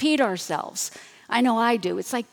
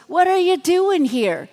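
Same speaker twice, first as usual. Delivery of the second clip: sarcastic; 6 semitones higher, 4 dB louder than usual. Shown as a demonstration. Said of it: "I don't think he's sarcastic when he goes".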